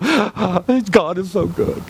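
gasp, then tearful gush; put-on emotional sigh of praise; mock choked-up awe. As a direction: play overwhelmed worshipper. Acts emotional congregation moved by God.

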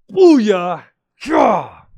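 loud, joyful whoop; excited outburst. Real, not acted. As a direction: burst out with delight. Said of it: "I fell in love with this verse".

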